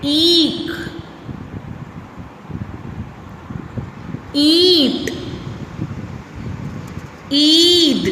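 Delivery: ईख, ईंट, ईद with a long ई pressed hard; stressed long ई. Shown as a demonstration. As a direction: lean on the long ई. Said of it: "वो कैसे?". (ई पर जोर देकर)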